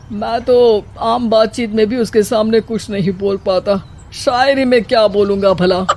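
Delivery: a hint of a fed-up father-in-law; lightly voiced father-in-law. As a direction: grumble about his poet wife.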